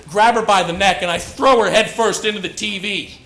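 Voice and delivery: normal talking voice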